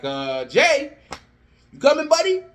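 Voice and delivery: puzzled official calling backstage; calling out as Adam. Acted. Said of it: "Adam's like".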